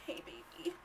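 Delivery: soft, high "hey baby" imitating him; mock sweet-talk. Mocking the guy's smooth greeting, high and soft. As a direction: coo it like a smooth talker. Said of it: "and he's like".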